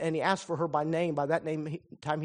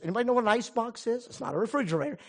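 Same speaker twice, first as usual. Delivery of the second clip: usual speaking voice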